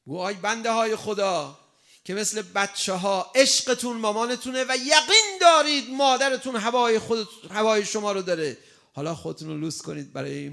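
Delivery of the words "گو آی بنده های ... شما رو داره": loud, high impassioned preaching; a preacher lifting the crowd to tears and joy; preaching at full force. Acted. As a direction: pour it out to the congregation with full fervour. Fervent sermon opening.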